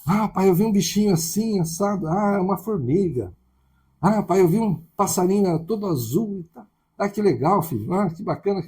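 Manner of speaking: a hint of an excited child and a gentle father; child and father dialogue. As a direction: play a little kid telling dad about his day, with dad answering kindly. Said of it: "Que o filho vem".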